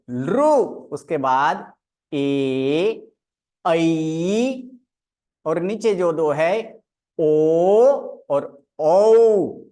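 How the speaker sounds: each Hindi vowel drawn out clearly for students; vowel recitation. Shown as a demonstration. Teacher reciting the vowels for the class. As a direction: recite the vowels slowly one by one. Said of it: "स्वर का उच्चारण कर लेते हैं".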